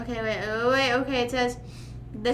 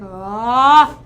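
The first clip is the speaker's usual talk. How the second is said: loud imitated ah; 12 dB louder than usual. Shown as a demonstration. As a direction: belt out a sudden ah. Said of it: "you know that sound that's like".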